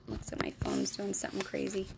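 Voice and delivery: whispered aside. Real, not acted. Reader whispering about her phone mid-reading. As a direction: whisper so nobody wakes.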